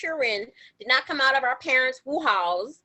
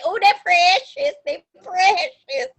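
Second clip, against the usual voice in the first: tender precious; 4 semitones higher, 4 dB louder, slower than usual. Shown as a demonstration. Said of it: "That's why you say".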